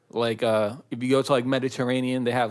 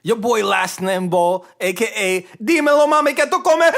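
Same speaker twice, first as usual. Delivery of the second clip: hype announcer; 8 semitones higher, 8 dB louder than usual. Pretend. Hype intro of co-host.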